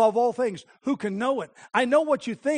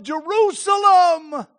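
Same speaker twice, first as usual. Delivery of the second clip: slow, louder cry of Jerusalem, pitched higher; anguished cry; 6 semitones higher, 8 dB louder, slower than usual. Acted. Jesus lamenting over Jerusalem, loud slow anguished cry. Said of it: "Because it's important, because of emphasis".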